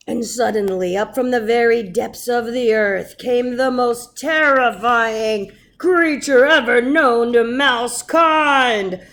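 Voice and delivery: booming storybook reveal; dramatic reveal. A narrator's reading. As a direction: announce the terrible beast.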